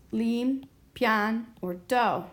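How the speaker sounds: "lim, pian, or do" said as separate beats; syllable by syllable. Shown as a demonstration. Teacher asking which syllable gets stress, "lim, pian, or do" in separate pieces. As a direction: sound out "lim", "pian", "do" one at a time, with pauses. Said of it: "which of these three syllables".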